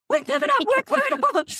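high, squealing joyful coworker. Retold or imitated. Coworker squealing over the phone on hearing she survived. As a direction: shriek it in giddy disbelief. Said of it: "She's like".

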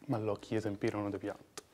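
ordinary talk, nothing marked

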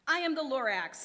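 spoken through a cough; a sick, coughing Lorax. Acted. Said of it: "he coughed and he whiffed".

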